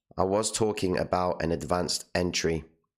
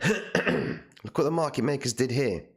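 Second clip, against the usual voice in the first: voice going; 6 semitones higher than usual. Real, not acted. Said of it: "God, my voice is going".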